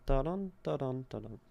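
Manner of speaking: "Da-dun" with the second beat stressed; iambic beat. Shown as a demonstration. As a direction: chant the iambs. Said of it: "fem is definitely very stressed".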